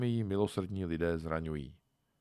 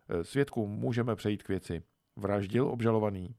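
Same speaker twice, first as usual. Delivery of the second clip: ordinary delivery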